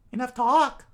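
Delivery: high, loud curt command; loud high cutoff. Acted. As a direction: cut them off hard. Robin cuts in.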